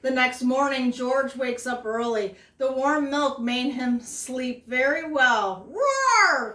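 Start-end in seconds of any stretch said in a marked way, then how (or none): 5.7-6.5 high playful roar; dinosaur roar. Acted. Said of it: "cries George".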